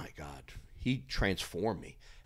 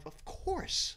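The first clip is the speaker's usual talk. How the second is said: eager yes; 4 dB quieter than usual. Retold or imitated. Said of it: "I said".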